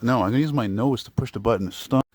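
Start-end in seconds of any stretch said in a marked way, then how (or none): none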